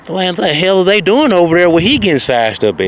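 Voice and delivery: high, nosy bridesmaid voice; bridesmaids gossiping. Retold or imitated. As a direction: squeal it out like nosy onlookers. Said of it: "They said".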